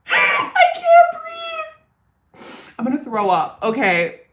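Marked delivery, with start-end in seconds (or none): 0.1-2.4 shrieking high through helpless laughter; laughing too hard to get the words out; can't breathe laughing. Real, not acted. Vlogger cracking up at her own lyric, shrieking with laughter.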